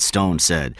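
ordinary delivery, nothing marked

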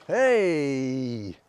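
long celebratory call; drawn-out cheer. Acted. Storyteller cheers the healing, long drawn-out call. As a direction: stretch it out as a cheer.

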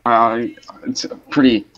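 normal talking voice

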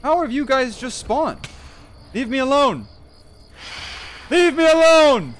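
high, loud panicked pleading; panicked yelling. Real, not acted. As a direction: yell at the mobs in panic. Mobs swarm him at night.

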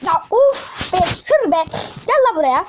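loud, high exasperated yell at the screen; annoyed yelling. Real, not acted. Gamer yelling at a truck blocking him, loud annoyance. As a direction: shout at the truck to get out of the way.

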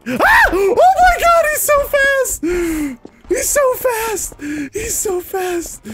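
very high, drawn-out panicked scream; hammed-up terror, squealing; terrified shriek. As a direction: shriek at a fast pursuer, gradually losing force. Chaser appears suddenly.

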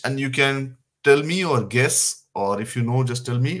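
ordinary talk, nothing marked

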